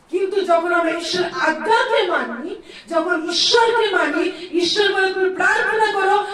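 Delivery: loud, high, rousing sermon delivery; full-force revival preaching; fervent preaching. Acted. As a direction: preach it out loud to lift the crowd.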